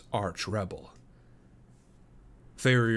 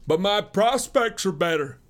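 higher, louder heated retort; 10 semitones higher, 6 dB louder than usual. Acted. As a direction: snap back with rising heat. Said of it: "said the other warmly".

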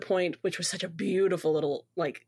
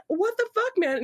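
high-pitched mock indignation; hammed-up grumpy complaint; grumpy outburst; 11 semitones higher than usual. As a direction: grumble in exasperated protest. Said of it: "goes on this big grumpy rant".

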